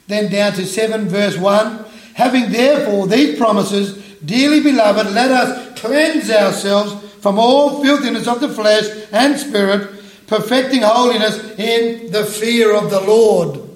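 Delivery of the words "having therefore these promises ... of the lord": louder, raised solemn reading voice; scripture reading. Acted. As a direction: proclaim the verses to the congregation. Reads scripture aloud.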